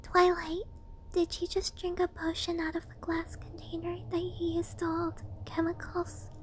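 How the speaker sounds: breathy, alarmed whisper; whispered question. Acted. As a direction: hiss it out in disbelief. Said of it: "one of the other ponies questioned".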